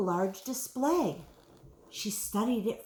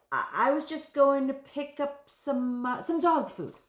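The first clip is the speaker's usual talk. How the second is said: hesitant stammer, pitched higher; 6 semitones higher than usual. A narrator's reading. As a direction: stumble over the words, mildly flustered. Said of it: "Helen stammered".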